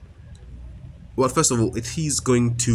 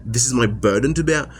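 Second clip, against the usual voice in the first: ordinary talk, nothing marked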